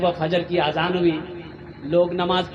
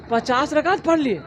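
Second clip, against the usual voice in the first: higher, astonished echo; incredulous question; 6 semitones higher than usual. Retold or imitated. Host doubting the guest's fifty rakats, incredulous. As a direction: query the claim in imitated disbelief.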